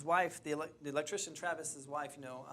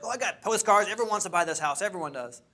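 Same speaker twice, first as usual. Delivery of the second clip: boastful quote; 4 semitones higher, 10 dB louder than usual. Retold or imitated. Quoting the seller's boast.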